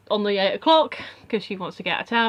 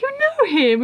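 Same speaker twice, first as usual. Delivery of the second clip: surprised question; 14 semitones higher, 4 dB louder than usual. Retold or imitated.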